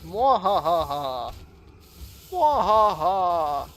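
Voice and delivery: loud, slow villain cackle; slow evil cackle. Pretend. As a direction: drag out the evil laugh. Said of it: "I'm an evil genius".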